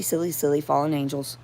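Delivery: ordinary delivery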